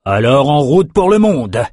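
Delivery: loud, rising rallying cry; exultant shout to set off; jubilant. A narrator's reading. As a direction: call out in triumph, voice climbing. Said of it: "jubila Nathan".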